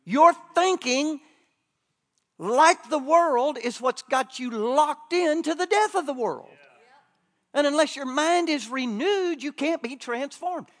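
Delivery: loud, driving sermon cadence; preaching at full force. Acted. Preacher warning the church about the carnal mind, loud and driving. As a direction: hammer the warning home to the congregation.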